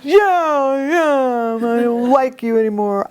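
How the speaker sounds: put-on sulky child. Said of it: "And he's like".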